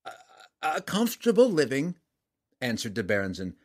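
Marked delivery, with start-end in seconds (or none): 0.0-2.0 nervous merchant. A narrator's reading. Merchant answers the king nervously.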